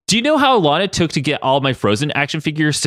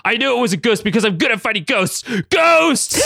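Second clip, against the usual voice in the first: shouting; 10 semitones higher than usual. A narrator's reading. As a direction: bellow it, rising to a yell. Logan still yelling about ghosts.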